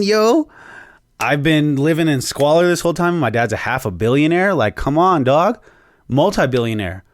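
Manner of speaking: a hyped-up furious Arthur demanding his share; freaking out. Retold or imitated. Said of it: "Arthur freaks the fuck out".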